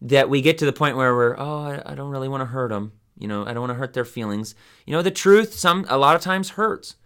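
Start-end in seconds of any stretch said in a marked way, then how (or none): 1.4-4.6 much softer, anxious hedging; mock overly gentle, softer; timid. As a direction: back away from saying the hard thing. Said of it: "we don't wanna be so gentle".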